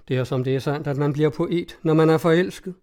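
ordinary delivery, nothing marked

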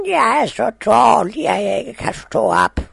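high, loud weak granny shout; old grandmother shouting. Acted. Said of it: "råbte bedstemoren".

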